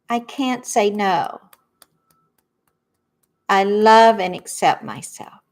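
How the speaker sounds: gentle lead-the-room affirmation; affirmation recitation. Acted. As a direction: say each phrase clearly so they can echo it. Leads tapping affirmations to repeat.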